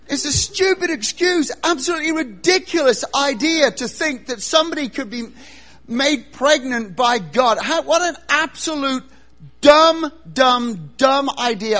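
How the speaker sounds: loud, high, hammering disbelief; scornful rant. Acted. Mate scorning pregnancy by God, ranting loudly. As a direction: pound out the scorn at high pitch.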